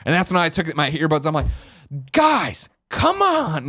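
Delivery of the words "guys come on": high-pitched exasperated mock yell; imitated scream. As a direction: act out screaming at fellow passengers to stop. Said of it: "like, scream".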